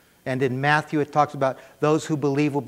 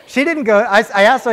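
laughing; 7 semitones higher, 10 dB louder than usual. Real, not acted. Laughing at the memory.

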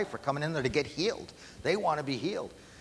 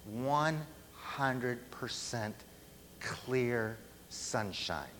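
slow emphasis; 6 dB quieter, much slower than usual. Acted. Emphatic punchline of sermon illustration.

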